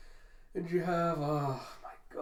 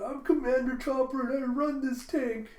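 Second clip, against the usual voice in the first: adorable little squeaky-high commander; Chopper; 10 semitones higher, 4 dB louder than usual. Acted. Little Chopper declaring command of the tank, cute and high. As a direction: pipe up cutely, high and proud. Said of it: "cute little adorable Chopper going".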